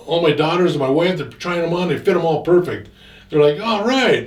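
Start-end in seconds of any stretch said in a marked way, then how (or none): 3.6-4.3 high, loud mock cheer; delighted. Daughters and wife trying on jackets, delighted. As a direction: imitate a thrilled family cheer.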